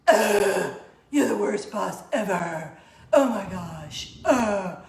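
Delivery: put-on loud, slow disgusted teenage whine with a laugh; teen groan at boss. Worker imagining groaning at the boss. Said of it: "respond with".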